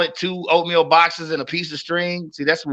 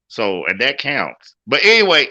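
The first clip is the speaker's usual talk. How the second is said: ordinary talk, nothing marked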